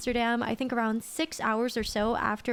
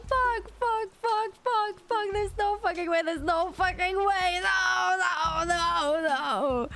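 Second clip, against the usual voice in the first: wailing, close to tears; anguished wail; 10 semitones higher, slower than usual. Retold or imitated. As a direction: cry out in despair. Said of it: "So I was just like".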